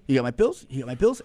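the friend mimicking the father-in-law's meek question. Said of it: "this is what he says to you".

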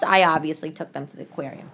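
ordinary delivery

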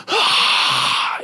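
forceful rush of breath; mighty roar. Acted. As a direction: blast out a roar like an almighty God. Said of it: "You mean the God that can go".

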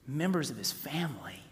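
hushed whisper of the answer; whispered repeat. Real, not acted. Preacher whispering the answer to believers. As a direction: whisper it so it sinks in.

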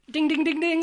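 sing-song ding ding like a quiz bell, pitched high; imitated quiz-show chime; mock bell. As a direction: play the game show chime. Game-show correct-answer bell.

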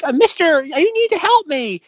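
very high mock plea for help; imitated pleading girl. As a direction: beg in a high put-on girl voice.

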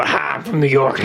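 mock New York accent; New York accent, lightly done. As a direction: drop the act into a New Yorker. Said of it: "you go".

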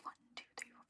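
quick whispered count to herself; whispering. Real, not acted. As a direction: count it off under your breath. Counting the list under her breath.

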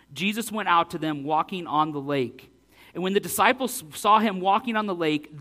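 ordinary delivery

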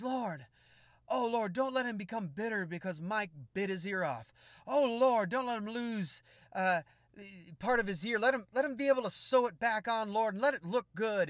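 high, loud, pleading prayer voice; hammed-up intercessor begging the Lord; fervent intercessor. Intercessor pleading for Holyfield, high and loud. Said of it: "She was going".

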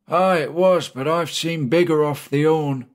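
a hint of a boastful old deckhand; old Charlie topping the others' tale; old sailor.

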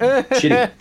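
high laughing blurt of the word; laughing shout. Real, not acted. Friend finishes the scotch story, laughing. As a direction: burst out laughing on the punchline.

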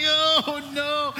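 high, drawn-out cry of dismay; hammed-up high moan; mock dismay.

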